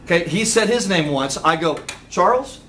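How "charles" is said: "Charles" echoed back, rising and a bit louder; upward intonation. Shown as a demonstration. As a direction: repeat "Charles" as a checking question. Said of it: "make sure that you have upward intonation".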